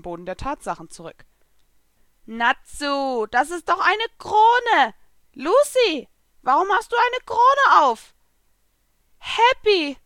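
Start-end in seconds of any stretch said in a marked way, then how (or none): 2.3-8.1 high, loud little-cat voice; astonished, drawn-out high creature voice; Happy. A narrator's reading. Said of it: "die kleine blaue Katze". 9.2-10.0 high, loud happy call; overjoyed exclamation; delighted cry. A narrator's reading. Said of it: "rief ich erfreut".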